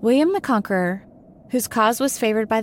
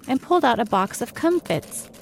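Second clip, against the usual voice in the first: ordinary delivery, nothing marked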